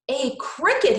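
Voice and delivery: a delighted cry of discovery; boy thrilled at his find; excited exclaim. A narrator's reading. Boy finds a cricket, excited cry. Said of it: "he exclaimed".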